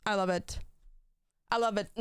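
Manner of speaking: usual speaking voice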